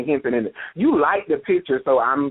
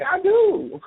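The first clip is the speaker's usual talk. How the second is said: high retold reply. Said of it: "I'm like".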